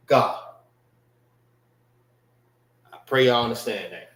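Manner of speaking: ordinary talk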